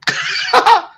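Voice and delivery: high squeaky laugh; high-pitched laugh. Real, not acted. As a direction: laugh up high after the joke. Laughs after mock voice.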